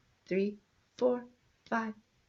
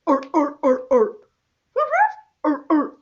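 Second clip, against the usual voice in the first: seal bark; 7 semitones higher, 9 dB louder than usual. Pretend. Imitating seals to the dog.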